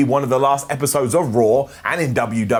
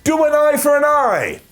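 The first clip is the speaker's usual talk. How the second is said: mock crowd yell, higher and louder; crowd shout; 10 semitones higher, 4 dB louder than usual. A fan yelling at the ring, mock crowd shout. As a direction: shout it from the stands. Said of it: "somebody in the crowd shouted".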